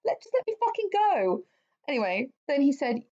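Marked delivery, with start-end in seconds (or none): 0.1-1.4 pitched way up, laughing half-shout; mock exasperated plea. Victim pleading to leave, high laughing mock whine. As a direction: squeal it like a fed-up captive. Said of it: "voicing victim's exasperation".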